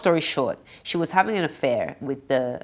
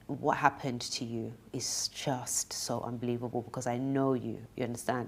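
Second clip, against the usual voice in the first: softly, low, almost whispered in places; retold hushed confession; 3 semitones lower, 7 dB quieter than usual. Retelling a crying friend's confession, hushed. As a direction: quote a hushed, heartfelt confession.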